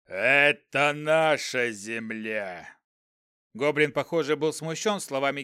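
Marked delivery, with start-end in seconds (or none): 0.1-2.8 goblin voice. Acted. Goblin answers general.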